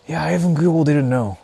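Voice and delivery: ordinary talk, nothing marked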